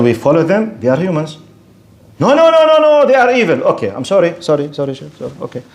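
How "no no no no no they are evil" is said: high-pitched string of objections; mock-alarmed objector cutting in; hasty protest. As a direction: protest in a raised, higher voice. Acts out a listener's hasty protest.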